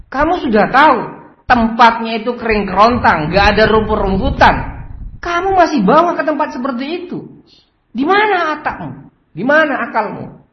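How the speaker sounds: high, loud furious scolding; angry boss. Acted. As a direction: berate the careless worker at full voice. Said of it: "Akhirnya dia marah-marah".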